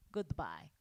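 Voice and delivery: low, soft character goodbye; searching for character voice. Acted. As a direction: drop the put-on voice low for farewell. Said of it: "I can't find it".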